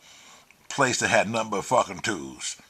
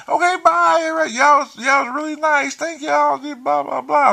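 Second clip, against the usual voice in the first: very high, loud mock female voice; shrieky, drawn-out mock farewell to new friends; imitated gushing goodbye; 7 dB louder, slower than usual. Imitating the woman saying bye to the drug boys, gushing high and loud.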